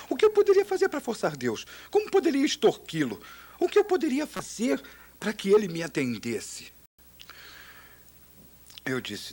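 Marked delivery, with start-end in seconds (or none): 0.1-6.7 high, whiny mock voice of his own scheming; high mock whine. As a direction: squeak it out like a silly plotter. Mocking his own desperate scheming. (voz fina e chorosa de deboche)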